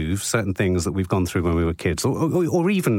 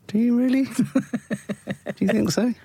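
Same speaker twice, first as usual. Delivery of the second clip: high, teasing doubt; mock disbelief; 13 semitones higher than usual. Real, not acted.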